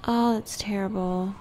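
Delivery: ordinary talk, nothing marked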